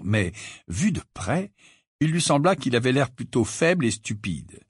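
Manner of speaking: plain reading voice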